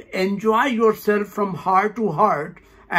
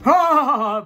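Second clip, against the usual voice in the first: high forced exhaled laugh; demonstrated breathy laugh; 5 semitones higher, 4 dB louder than usual. Shown as a demonstration. Teacher demonstrates breathe and laugh, forced laughter. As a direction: let the breath burst into a laugh. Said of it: "Breathe and laugh".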